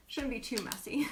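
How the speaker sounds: usual speaking voice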